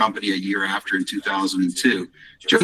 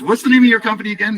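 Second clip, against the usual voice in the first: eager question; 11 semitones higher, 5 dB louder, faster than usual. Retold or imitated. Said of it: "And he says".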